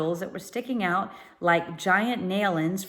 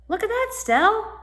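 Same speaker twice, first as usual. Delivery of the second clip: excited; 14 semitones higher, 4 dB louder than usual. A narrator's reading. Tom spotting boat excitedly.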